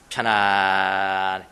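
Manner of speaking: very slow, low, stretched ending; drawn-out comfort. Shown as a demonstration. Preacher explains the original meaning of comfort, drawn out low. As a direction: let the last syllable sink and linger.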